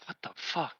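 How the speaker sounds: unvoiced whispered what the fuck; whispered disbelief. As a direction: breathe it out in disbelief. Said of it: "I'm like".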